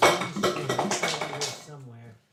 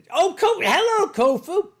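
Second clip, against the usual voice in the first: high, loud delighted hello; light up as your friend walks in; excited greeting; 12 semitones higher, 6 dB louder than usual. Real, not acted. Greeting a newly arrived friend.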